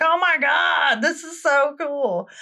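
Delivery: high-pitched gushing, slowing on the last words; mock awe. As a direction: imitate a thoroughly thrilled prince. Prince thrilled by second reveal.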